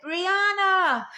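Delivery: high, loud call of a name; calling out across a room. Retold or imitated. Calling to a coworker in the kitchen from the front line, loud high call.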